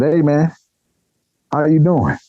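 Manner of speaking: high, upbeat street-guy greeting; friendly street greeting. Retold or imitated. Stranger greets him in the park.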